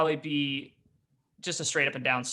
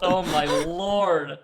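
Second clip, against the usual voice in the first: drawn-out, louder exclamation of disbelief; amazed; 3 semitones higher, 6 dB louder, much slower than usual. Real, not acted. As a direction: stretch it out slowly in real astonishment. Reacting to a very high roll.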